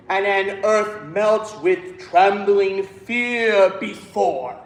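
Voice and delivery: slow, "earth", "melts", "trembling fear" each weighted; emphasized text. Shown as a demonstration. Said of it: "We need all those texts".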